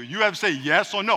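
ordinary talk, nothing marked

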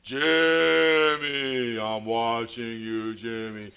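long-drawn low spooky call, fading off; eerie hidden caller. Retold or imitated. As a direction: spook a child from hiding, slow and low. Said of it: "he would hear this voice".

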